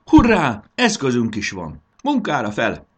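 high, loud cheer of delight; enthusiastic cheer. A narrator's reading. Marci cheers at finding a bucket, loud and eager. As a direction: cheer like a boy finding treasure. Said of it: "Lelkesedett a fiú".

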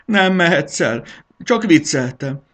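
high, loud pleading, easing towards the end; desperate begging. A narrator's reading. Botor begs his departing wife to stay, desperate loud pleading. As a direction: wail it as a frantic plea to stay. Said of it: "egyfolytában Botorkának könyörgött".

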